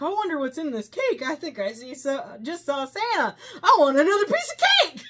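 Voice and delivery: loud, high giddy voice breaking into laughter; giddy laughing. Retold or imitated. The cake-eating guy who just saw Santa, giddy laughing shout. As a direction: gush through giggles about the cake. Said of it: "He's like".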